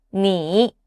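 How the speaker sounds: "Ni." dipping low, then turning up; low falling-rising "Ni."; third tone. Shown as a demonstration. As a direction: sink low in "Ni." and curve back up. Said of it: "common words with the third tone".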